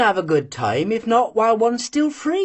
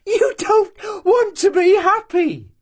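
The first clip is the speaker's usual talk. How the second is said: high, loud cry on "want"; 13 semitones higher, 4 dB louder than usual. A narrator's reading. Ustenka crying out at Marianka, high and loud. Ustenka pleading, then repeating.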